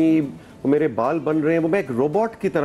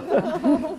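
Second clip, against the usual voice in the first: high laugh. Real, not acted. Laughing about being scolded.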